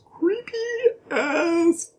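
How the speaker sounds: high, stretched squeal; mock creeped-out. Reader reacting to a talking demon baby, mock creeped-out. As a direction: squeal it slowly, high, with a shudder.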